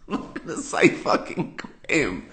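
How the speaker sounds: giggling mock outrage; laughing through it. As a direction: laugh as you swear it. Refusing the lawyers' word.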